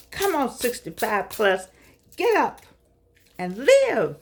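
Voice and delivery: high, loud rallying cry to get up; rousing call. Real, not acted. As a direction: urge them up like a cheerleader. Exhorting elders to live.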